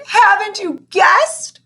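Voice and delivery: high, slow, emotional pleading; throbbing with emotion. Acted. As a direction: ache through the question. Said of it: "in a voice throbbing with emotion".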